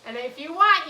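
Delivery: ordinary talk, nothing marked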